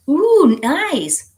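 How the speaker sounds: high, loud ooh of delight. Real, not acted. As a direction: coo with real delight at the news. Reacting to chat comment.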